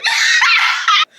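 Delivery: high-pitched burst of laughter; laughing. Real, not acted. Storyteller cracks up at her own joke, laughing.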